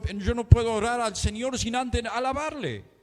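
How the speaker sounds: ordinary talk, nothing marked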